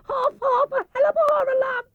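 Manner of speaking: very high panicked squeal; terrified Piglet. Acted. Piglet fleeing the heffalump, high panicked cry. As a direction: shriek for help in terror. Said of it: "still crying out".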